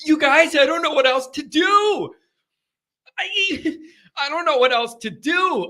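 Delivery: high-pitched, half-laughing helplessness; comic exasperated wail; mock despair. Mock despair at long off-season.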